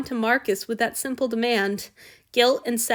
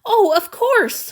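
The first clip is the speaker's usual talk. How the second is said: gasped; 11 semitones higher, 7 dB louder, slower than usual. A narrator's reading. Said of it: "Hanyu gasped".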